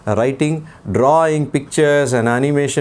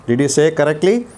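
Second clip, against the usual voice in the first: ordinary talk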